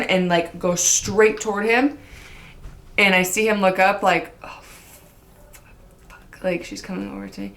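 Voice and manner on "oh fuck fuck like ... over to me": imitating his panicked whisper. Voicing his panicked thought.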